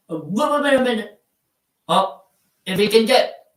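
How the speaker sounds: high mock British "wait a minute" with the t dropped; mock British accent. Shown as a demonstration. Streamer mocking British slang, high mock British accent. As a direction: pitch it up like a Brit. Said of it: "me saying".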